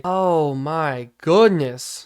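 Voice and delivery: very slow, drawn-out disbelief; stunned, stretched-out exasperation; real dismay. Real, not acted. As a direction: drag each word out in dismay. Dismay at rushing yards allowed.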